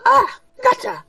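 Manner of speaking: a dainty merchant offering a fix; high shopkeeper voice. Acted. Shopkeeper assuring the party, high and fussy.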